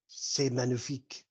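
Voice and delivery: hammy French foodie, lightly done; French accent. Acted. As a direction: put on a mock French accent. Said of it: "It's French".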